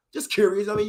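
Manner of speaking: normal talking voice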